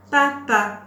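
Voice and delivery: loud, high, deliberate model word; word dictation. Shown as a demonstration. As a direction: call out each syllable clearly for a learner. Teacher dictates word for syllable listening.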